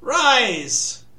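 ringing shouted royal command; solemn regal knighting. A narrator's reading. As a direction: call it out over the crowd. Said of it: "he cried".

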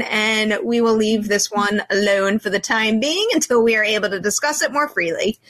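ordinary delivery

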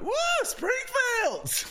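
very high, slow mock cheer; hammed-up high drawn-out whoop; cheering guy. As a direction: whoop it out like a delighted fan. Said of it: "And that guy's like".

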